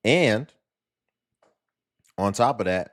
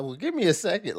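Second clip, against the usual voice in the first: high, light mock whine; mocking imitation; 10 semitones higher than usual. Cohost teasing the guest's excuse, light high mock whine. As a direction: tease her defense mechanism. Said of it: "to always be like".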